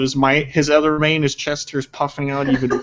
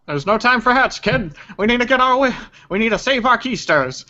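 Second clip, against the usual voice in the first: high-pitched acted urgency; urgent character voice; 9 semitones higher than usual. Man urging a kid to flee, high and urgent. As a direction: hurry the kid along in a raised voice.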